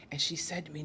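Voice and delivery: ordinary talk, nothing marked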